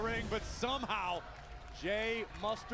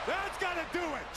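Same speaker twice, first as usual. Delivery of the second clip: excited call; 7 semitones higher than usual. Real, not acted. Colour man at a big moment.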